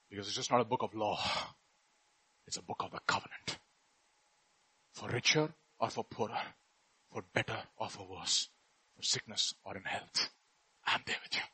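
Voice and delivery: soft, fast, near-whisper vow; hushed and intimate. Acted. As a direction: draw them close and promise quietly. Intimate covenant promise.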